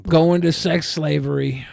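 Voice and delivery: normal talking voice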